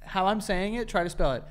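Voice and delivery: ordinary delivery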